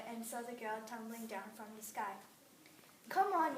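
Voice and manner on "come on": loud, high call; yelled. Acted. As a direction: shout it to your partner. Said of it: "yelled one swan".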